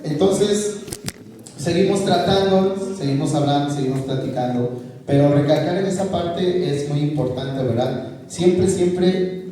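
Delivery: normal talking voice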